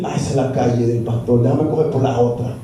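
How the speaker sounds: low, fast put-on voice of someone dodging the pastor; lightly mocking churchgoer ducking down another street; mocking a deserter. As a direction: sneer it out quickly, voice dropped low. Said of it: "hay otros que dicen".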